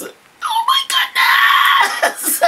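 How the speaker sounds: very high, breaking into laughter; giddy laugh. Real, not acted. Overwhelmed by mosaic tiles, giddy high laugh. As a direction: let the joy spill into a laugh.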